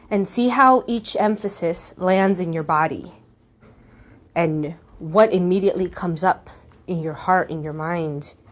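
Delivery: ordinary talk, nothing marked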